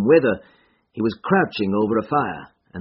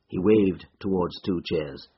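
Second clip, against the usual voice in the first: ordinary delivery, nothing marked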